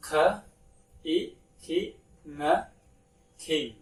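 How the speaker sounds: "k, i, ki, ng, king" built up sound by sound. Shown as a demonstration. Teacher blending sounds into a word for pupils. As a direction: blend "king" from its parts step by step. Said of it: "We pronounce king".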